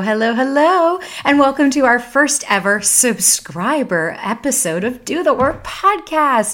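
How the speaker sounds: high, loud, drawn-out podcast welcome; excited show intro. Real, not acted. Host welcomes subscribers to first bonus episode, excited show intro. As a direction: belt out a big, slow, singsong hello.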